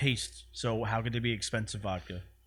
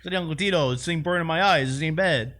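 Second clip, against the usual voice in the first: high, louder hammed-up taster; tasting in character; 5 semitones higher, 6 dB louder than usual. Customer trying Uncle Tito's vodka, eyes burning, high and loud. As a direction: wince then approve, hamming it up.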